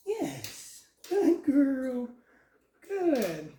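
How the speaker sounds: high, bright marker "Yes" then praise. Real, not acted. Trainer rewarding the dog for sitting, high and excited.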